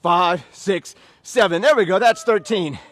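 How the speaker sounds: loud count and command; shouted workout call. Real, not acted. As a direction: bellow the instructions to the class. Trainer calls out count and instructions.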